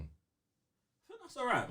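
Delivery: high, slow, stretched-out all right; drawn out high. Acted. Drawn-out mock agreement.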